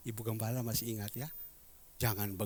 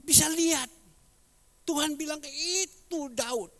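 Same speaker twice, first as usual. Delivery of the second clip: higher, louder sermon delivery; preaching; 11 semitones higher, 5 dB louder than usual. Acted. Pastor telling the church of David, preaching voice. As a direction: proclaim it to the room.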